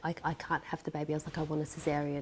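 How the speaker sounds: normal talking voice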